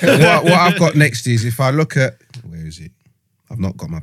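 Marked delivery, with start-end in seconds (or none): 0.0-2.2 laughing geezer voice. Pretend. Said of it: "doing this with a Tommy Vance".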